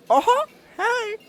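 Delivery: very high squeaky cartoon voice; Mickey Mouse voice. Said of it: "the Mickey Mouse voice".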